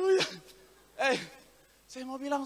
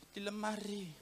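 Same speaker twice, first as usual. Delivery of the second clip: hushed little mock answer; reply so softly it barely carries; 2 semitones lower, 16 dB quieter, slower than usual. Wife answers husband's second call about fish, very soft reply. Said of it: "tapi pelan".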